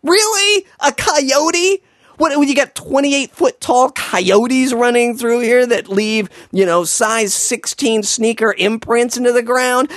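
high, incredulous sarcasm; mock the idea with rising disbelief; sarcastic disbelief. Mocking the older boy's coyote guess, high sarcasm.

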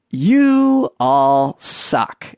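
slow, drawn-out grumbling verdict; mock exasperation. Hiker telling how flip-flop and barefoot people outdo them, mock exasperated. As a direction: drag out every word in playful disgust.

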